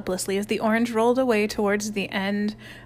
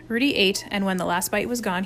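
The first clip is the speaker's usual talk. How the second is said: usual speaking voice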